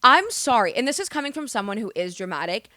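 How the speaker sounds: usual speaking voice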